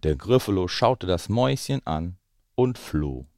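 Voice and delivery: put-on lively read-aloud voice for children; story narration. A narrator's reading. Parent reading The Gruffalo to the children, lively storytelling. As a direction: tell it like a bedtime story. Reads picture book aloud.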